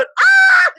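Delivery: loud, high group shriek; a loud, high shriek of laughing surprise; team screaming. Retold or imitated. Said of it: "And they go".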